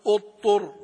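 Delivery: اضطر said as utur, with no ض at all; wrong, ض dropped. Shown as a demonstration. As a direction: drop the ض in اضطر the way some readers do. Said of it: "بعضهم لا يقرأ لا ضاد ولا شيء". (نطق خاطئ بلا ضاد)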